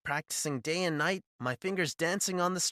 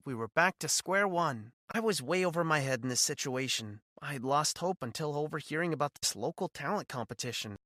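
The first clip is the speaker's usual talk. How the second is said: ordinary delivery, nothing marked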